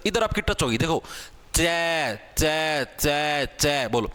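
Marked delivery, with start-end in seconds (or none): 1.5-4.1 चे repeated clearly, tongue on the palate; teacher demonstrating चे for students; sound demo. Shown as a demonstration. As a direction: say चे again and again to show it. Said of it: "चे बोल के देखो".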